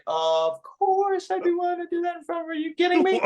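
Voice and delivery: hammed-up disbelief that anyone would ask; mock incredulous. Comic telling reporter he'd gladly mock Trump, incredulous. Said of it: "And I'm like".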